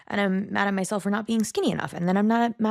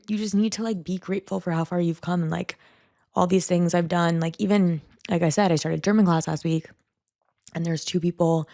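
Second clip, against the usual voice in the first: usual speaking voice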